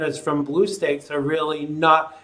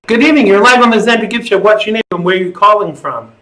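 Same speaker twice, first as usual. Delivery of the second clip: radio host; 4 semitones higher, 9 dB louder, faster than usual. Acted. Host opens a call-in show.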